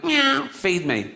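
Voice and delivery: high, slow pleading meow; whiny cat meow. Acted. To the audience, the cat demanding food: high drawn-out meow. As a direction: stretch the meow out, begging like a cat.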